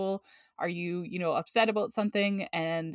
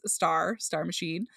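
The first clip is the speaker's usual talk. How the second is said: ordinary talk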